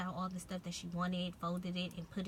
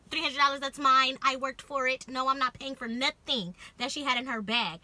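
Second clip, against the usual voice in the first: loud, higher retold self-defense; firm protest; 3 semitones higher, 8 dB louder than usual. Telling security the money is hers, loud firm protest. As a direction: state your case loudly and firmly.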